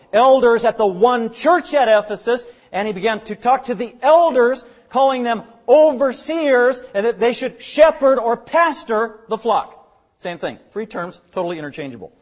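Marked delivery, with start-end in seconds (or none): 0.1-9.9 high, loud preaching voice, building; emphatic preaching. Real, not acted. Preacher urging a plurality of elders on the church, forceful. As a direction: build in force as you press the scripture home.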